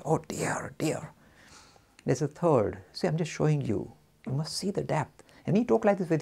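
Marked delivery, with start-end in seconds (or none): 0.0-1.1 breathy mock-dismayed whisper; whispered oh dear. Teacher voicing the stumped believer, whispered. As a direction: sigh the words out in a defeated whisper.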